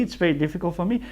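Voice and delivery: normal talking voice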